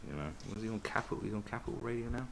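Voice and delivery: ordinary talk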